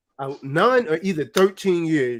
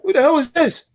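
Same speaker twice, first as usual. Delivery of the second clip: mock suspicious; 7 semitones higher than usual. Retelling answering the phone.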